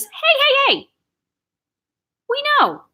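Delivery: high, loud call to an interrupter. Real, not acted. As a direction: break off and call out sharply high. Breaks off to quiet an interrupter.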